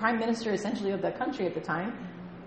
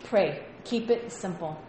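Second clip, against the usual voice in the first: ordinary talk, nothing marked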